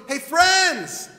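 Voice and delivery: shouted hey, friends; calling out. Acted. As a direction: call across the water to get attention. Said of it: "He's going".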